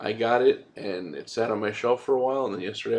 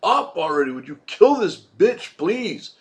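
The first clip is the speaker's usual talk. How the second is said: exasperated yell; 7 semitones higher, 4 dB louder than usual. Retold or imitated. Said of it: "It was just like".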